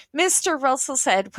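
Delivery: plain reading voice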